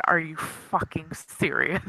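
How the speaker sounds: slow, quiet incredulity; softly exasperated; deadpan disbelief. Acted. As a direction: drag it out, low in volume and unimpressed. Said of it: "has look on his face like".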